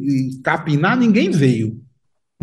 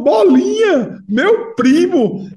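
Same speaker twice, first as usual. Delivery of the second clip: much higher, slow, stretched-out amazement; astonished disbelief; 11 semitones higher, 4 dB louder, much slower than usual. Retold or imitated. Delegate realizing the suspect is his cousin, astonished. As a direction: hit the pitch up and drag each word.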